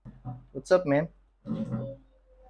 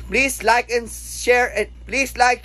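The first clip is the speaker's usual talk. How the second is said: drawn-out outro plea; 7 semitones higher, much slower than usual. Acted.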